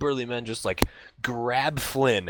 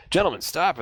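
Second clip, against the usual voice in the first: calling out; 7 semitones higher, 4 dB louder than usual. Acted. Marcus calls after the thugs.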